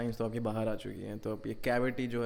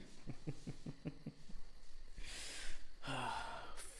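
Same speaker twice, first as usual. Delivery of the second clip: quiet evil laugh; 3 semitones higher, 8 dB quieter than usual. Acted.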